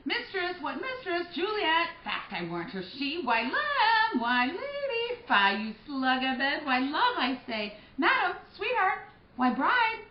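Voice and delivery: high, loud calls to a sleeper; sing-song wake-up calls up the stairs; calling out. Acted. Nurse calling sleeping Juliet on her wedding morning, high and loud.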